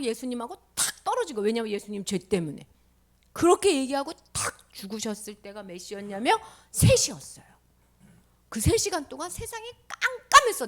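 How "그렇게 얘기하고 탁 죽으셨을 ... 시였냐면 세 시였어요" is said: normal talking voice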